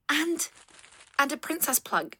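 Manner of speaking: high, loud, giddy excitement; gushing delight. Acted. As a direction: gasp and gush thanks for a gift. Acted delight at birthday gift.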